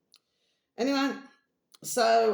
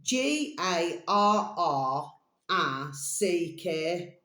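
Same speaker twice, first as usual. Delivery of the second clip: slow, each letter said separately with gaps; spelling a name aloud for viewers; spelled out letter by letter; much slower than usual. Shown as a demonstration. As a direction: spell it out slowly, one letter at a time.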